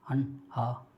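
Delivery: हन, हा breathed from the throat as a soft guttural ha; throat ha sound. Shown as a demonstration. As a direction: push हा from the throat to show the sound. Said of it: "कंठ यानी".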